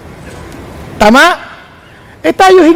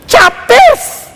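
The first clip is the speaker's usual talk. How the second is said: amazed emphasis; 12 semitones higher than usual. Real, not acted. Preacher marvels at memorized chapters.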